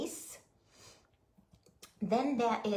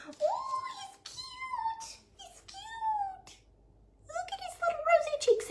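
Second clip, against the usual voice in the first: sympathetic aww. Real, not acted. Cooing over finished nutcracker.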